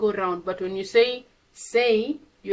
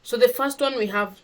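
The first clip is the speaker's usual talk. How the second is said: ordinary delivery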